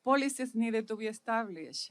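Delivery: usual speaking voice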